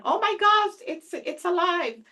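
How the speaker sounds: high-pitched, acted-out astonishment; amazed gasp of recognition. Host recalling the robot's wink in iRobot, mock amazement. Recalling reaction to movie scene.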